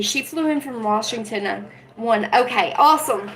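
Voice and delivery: usual speaking voice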